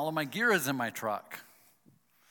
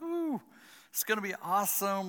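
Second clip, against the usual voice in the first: excited; 6 semitones higher than usual. Retold or imitated. Retold happy moment with elk.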